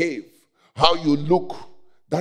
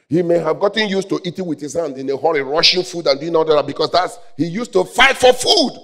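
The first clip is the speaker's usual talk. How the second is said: raised voice climbing to a shout; heated pulpit storytelling; rising preaching; 5 semitones higher, 6 dB louder than usual. Real, not acted. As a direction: build quickly to a loud, high finish.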